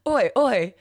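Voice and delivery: mock Australian "Oi, oi" through laughter; laughing Aussie "Oi, oi". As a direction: copy his Aussie "oi" laughing. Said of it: "Do an".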